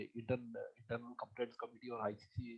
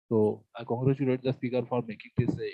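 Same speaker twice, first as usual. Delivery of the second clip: much louder, projecting clearly; 12 dB louder than usual. Real, not acted. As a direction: speak up so all can hear. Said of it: "now I am audible?".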